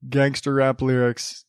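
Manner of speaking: normal talking voice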